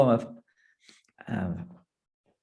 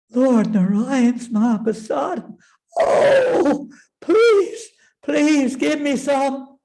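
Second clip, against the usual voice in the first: very high, loud, longing plea; eager begging; 12 semitones higher, 6 dB louder, slower than usual. Acted. Parvati begging Lord Shiva for some, high and eager. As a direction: beg for it, breathless with desire.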